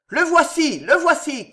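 high, loud shout from the landing. A narrator's reading. Gendarme on the landing spotting Andrea, shouting. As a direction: call out the find. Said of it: "cria une voix sur le palier".

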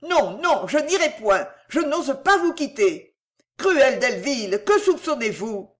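high, pleading protest; distressed refusal. A narrator's reading. Cécile refusing to leave Delville, distressed.

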